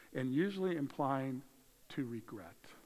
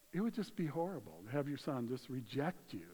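ordinary delivery

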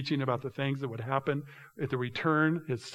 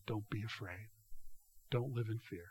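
soft reassurance; 4 semitones lower, 12 dB quieter than usual. Acted. Preacher voicing God's reassurance.

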